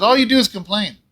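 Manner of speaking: high, loud scolding wife. Retold or imitated. Wife scolding her bedridden husband, loud and high. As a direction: raise the voice to scold him. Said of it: "She goes".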